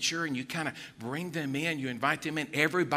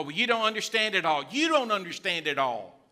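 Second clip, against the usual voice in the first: louder, higher, mock dismissive rebuke; mock scolding; 4 semitones higher, 6 dB louder than usual. Parent brushing off a child's questions about faith, mock scolding. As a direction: scold as a parent who has no patience.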